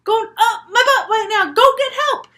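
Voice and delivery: high, loud comic freak-out; frantic, hammed-up outburst; panicked cry for help. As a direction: yell for help in a high panic, half laughing.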